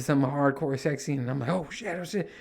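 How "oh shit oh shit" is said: high, hushed nervous mutter. Retold or imitated. Dad spotting a sudden sex scene, nervous. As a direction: whisper it in a flustered panic. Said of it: "I'm nervous".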